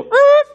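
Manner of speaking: loud, high imitated screech; chair scrape noise. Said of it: "my chair go".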